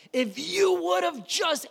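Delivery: Mary reproaching Jesus in anguish; grieving Mary. Retold or imitated. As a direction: plead with Jesus through grief. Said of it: "they're like".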